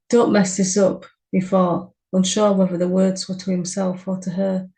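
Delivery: usual speaking voice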